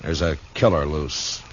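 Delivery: measured first-person narration over music; story narration. A narrator's reading. Dillon narrating his search for the killer, steady and low-key. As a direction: tell it like a tired lawman remembering.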